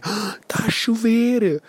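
gasp, then high, drawn-out announcement; mock astonishment. Facebook user announcing the rain, mock astonishment. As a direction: imitate someone amazed by ordinary weather. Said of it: "havia uma altura que é".

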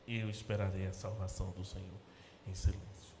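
soft and low, sinking into a whisper on em silêncio; quiet, unvoiced ending. Shown as a demonstration. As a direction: say it quietly, as if already in silence. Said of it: "repeats verse softly, in silence". (em voz baixa, quase sussurrando no fim)

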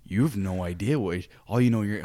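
usual speaking voice

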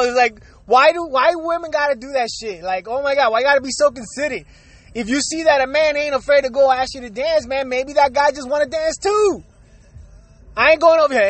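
ordinary delivery

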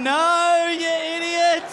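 high, drawn-out exasperated cry; high-pitched comic outrage; exasperated inner scolding. Acted. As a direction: let your brain wail at your own stupidity. His brain scolds him.

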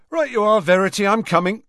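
high, loud, ready reply; prompt. A narrator's reading. George answers the shop assistant calling him, prompt. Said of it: "said George promptly".

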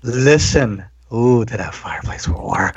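low unvoiced leering whisper; creepy whisper. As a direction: breathe it like a creep. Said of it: "in my most creepy man voice".

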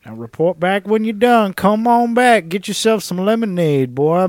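put-on Southern camp boss ordering his lackey, Southern accent. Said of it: "southern accents, giving orders".

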